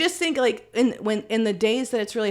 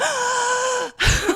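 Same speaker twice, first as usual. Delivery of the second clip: high, dramatic intake of breath; mock shocked gasp; 4 dB louder than usual. Acts future shock at kid's age.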